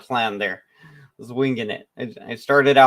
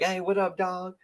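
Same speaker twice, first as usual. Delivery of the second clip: higher, soft mock buddy greeting; imitated casual slang greeting; 6 semitones higher, 5 dB quieter than usual. Greeting a friend, with imitated casual slang.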